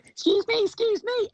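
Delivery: put-on very high, piping girl's voice; child calling out. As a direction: squeak it out like a hurried little girl. Child barges through the group.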